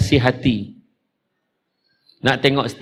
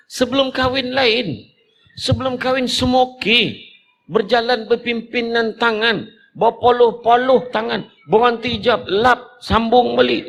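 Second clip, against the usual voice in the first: higher, sing-song teasing of courting couples; hammed-up lovers' cooing; mock lovey-dovey; 8 semitones higher than usual. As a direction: paint the couple as syrupy sweet. Mocking sweet courting couples.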